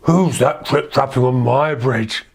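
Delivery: slow, menacing troll challenge. Acted. Troll challenges goat on his bridge, slow troll voice. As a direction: draw it out like a storybook troll. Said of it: "said the troll".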